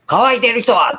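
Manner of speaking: high ringing cry to the crowd; loud high call to all who thirst. Retold or imitated. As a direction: call it out as Jesus standing up. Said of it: "大声で".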